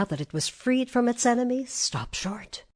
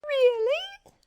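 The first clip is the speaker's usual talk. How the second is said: very high little question; squeaky mouse; 3 dB louder than usual. A narrator's reading. Mouse asks whether the lion is a coward, squeaky voice. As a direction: squeak it doubtfully. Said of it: "asked the mouse".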